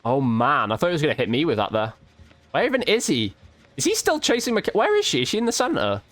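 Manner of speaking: ordinary delivery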